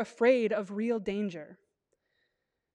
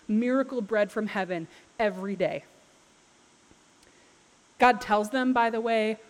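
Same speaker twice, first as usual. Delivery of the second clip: ordinary talk, nothing marked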